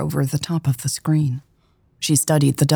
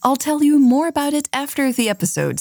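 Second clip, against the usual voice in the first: bright, higher podcast host greeting; cheerful host voice; upbeat host intro; 8 semitones higher than usual. Acted. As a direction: welcome the listeners warmly.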